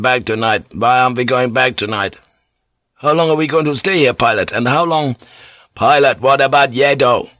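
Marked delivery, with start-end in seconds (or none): 5.7-7.3 raised, insistent voice; louder demand. Acted. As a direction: loudly press the pilot for an answer. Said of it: "Ginsel asked louder".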